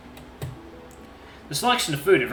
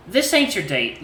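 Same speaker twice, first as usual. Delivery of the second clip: ordinary delivery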